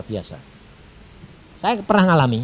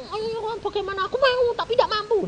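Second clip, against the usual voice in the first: mock whiny pray-er. Mocking whiny prayer.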